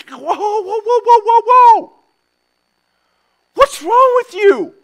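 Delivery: shocked crowd trying to stop him; alarmed crowd. Retold or imitated. Crowd shouting at Jesus flipping tables, alarmed.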